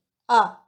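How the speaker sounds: "啊" short, loud and falling; fourth tone. Shown as a demonstration. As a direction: drop "啊" sharply from the top. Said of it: "Fourth tone".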